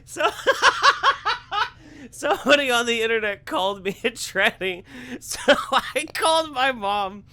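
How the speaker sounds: high-pitched, helpless laughing speech; laughing too hard to talk straight; cracking up. Real, not acted. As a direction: squeeze the words out through laughter. Cracking up while mocking the clip.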